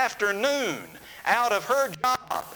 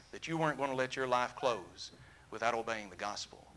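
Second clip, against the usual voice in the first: softer, gently comforting the paralysed man; quiet, sincere encouragement at his bedside; tender pastoral counsel; 7 semitones lower, 7 dB quieter than usual. Retold or imitated. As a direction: speak low and tender to a man who will not walk.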